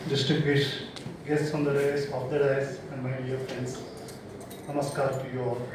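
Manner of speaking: normal talking voice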